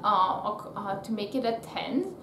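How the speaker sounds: ordinary talk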